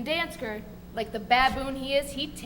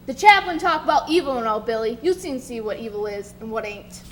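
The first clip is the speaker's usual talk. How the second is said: usual speaking voice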